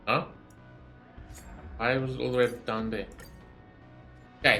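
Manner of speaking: normal talking voice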